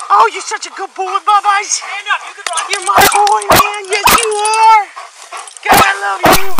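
high, sing-song praise for a good dog; fond high-pitched praise for the dog; doting dog praise. Real, not acted. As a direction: gush over him like a proud owner. Long baby-talk praise to dog.

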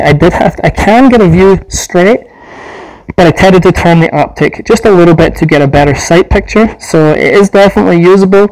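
muffled behind respirator; speaking inside full face mask; through a gas mask. Real, not acted. Seen 5: Speaker wears full gas mask throughout, aiming rifle while talking.

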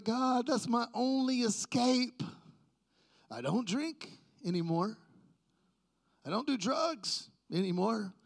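high, drawn-out protest, hammed up; mock pleading. Man arguing with God to keep his shows, mock pleading. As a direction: plead your case, stretching the words in exaggerated complaint. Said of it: "I'm trying to plead my case to God".

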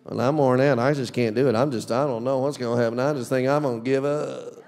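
a tired, beaten-down complaint; defeated whine. Retold or imitated. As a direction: sound like you've given up. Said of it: "how it's not released".

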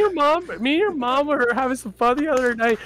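high, loud Canadian trash-talker; Shorzy. Acted. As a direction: brag in a squeaked-up Canadian taunting voice. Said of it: "just do Shorzy".

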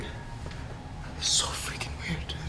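slow, breathless whisper; slow spooked whisper. Real, not acted. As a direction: whisper it so nothing hears. Spooked after hearing bangs.

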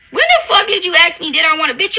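normal talking voice

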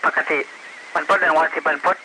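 crackly radio transmission; over walkie talkie. Real, not acted. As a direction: voice through a police radio. Police radio roll call. (เสียงผ่านวิทยุสื่อสาร)

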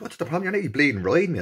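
high, loud indignant yell; retold furious yell in the water; angry shout. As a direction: shout him off your back. Retold row in the sea.

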